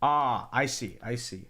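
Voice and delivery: ordinary talk, nothing marked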